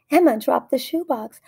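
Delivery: ordinary delivery, nothing marked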